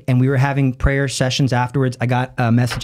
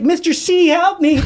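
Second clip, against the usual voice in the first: high-pitched mock cry for help; panicked student. Student shaking violently calls to his teacher, panicked. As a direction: imitate a shaken kid pleading, much higher. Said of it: "starts shaking and vibrating violently".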